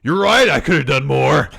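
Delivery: loud, high burst of bravado; hammed-up shouted comeback; mock wrestler roar. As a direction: erupt like a raging wrestler. Acting Braun suddenly erupting.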